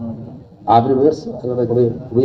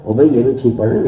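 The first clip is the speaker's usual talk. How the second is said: quick delivery; much faster than usual. Shown as a demonstration. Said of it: "میں بہت اچھی مثال آپ کو دے رہا ہوں".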